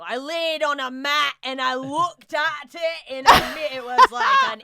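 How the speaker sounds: slow, loud Scottish monologue; Scottish accent. Retold or imitated. Comic reciting a vagina monologue, drawn-out Scottish accent. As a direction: draw it out in a broad Scottish accent. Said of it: "Can you do a Scottish accent?".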